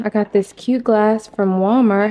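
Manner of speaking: ordinary talk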